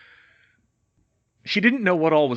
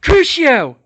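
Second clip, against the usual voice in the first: high, very loud witch's shriek; screamed curse; 14 semitones higher, 13 dB louder than usual. Acted. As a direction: fling the curse. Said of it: "Bellatrix yelled".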